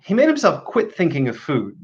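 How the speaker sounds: ordinary delivery, nothing marked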